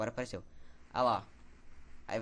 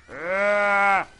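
sudden loud scream of fright; startled scream; 5 semitones higher, 13 dB louder than usual. Real, not acted. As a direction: yelp in real panic. Startled by monster in game.

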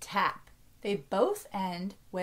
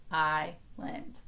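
"Island" stretched in two slow parts, lower; slightly slower; 4 semitones lower than usual. Shown as a demonstration. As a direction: say "Island" slowly, a small gap between syllables. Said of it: "say it a little slower".

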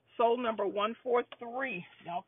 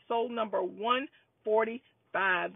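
usual speaking voice

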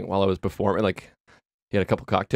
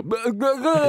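mock flubbed babble; 13 semitones higher, much slower than usual. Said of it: "where I just go".

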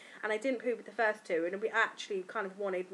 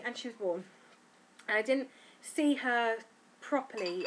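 normal talking voice

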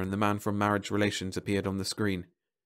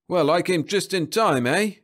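loud, higher-pitched policeman voice; cop; 9 semitones higher, 6 dB louder than usual. A narrator's reading. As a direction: play the officer taking charge. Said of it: "said the cop".

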